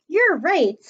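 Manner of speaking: ordinary talk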